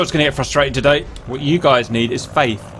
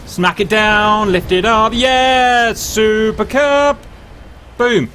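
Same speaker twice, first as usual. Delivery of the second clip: gleeful triumph; 6 semitones higher, much slower than usual. Real, not acted. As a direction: celebrate the landing.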